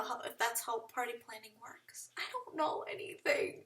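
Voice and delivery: whispered embarrassed aside. Real, not acted. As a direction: whisper it, a little ashamed.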